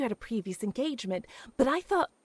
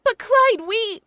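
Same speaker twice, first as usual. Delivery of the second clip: higher and louder, rising panic; shocked pleading; 6 semitones higher, 6 dB louder than usual. Acted. Woman jilted on the phone, pleading in shock. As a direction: cry out in disbelief as he breaks it off.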